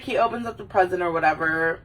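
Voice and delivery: usual speaking voice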